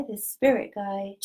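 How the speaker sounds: ordinary talk, nothing marked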